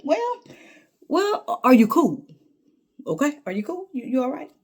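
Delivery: high, surprised well well; a mock startled reassurance; high, incredulous checking in. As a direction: ask if he's cool, stunned. Retold coaxing reply.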